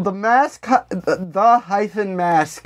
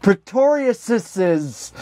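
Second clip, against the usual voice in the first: careful slow reading; much slower than usual. Real, not acted. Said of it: "this fucked me up last time".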